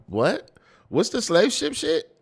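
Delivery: high, loud, slowed disbelief; baffled, stretching out the question loudly; incredulous outburst. Real, not acted. Baffled by confusing rap bars.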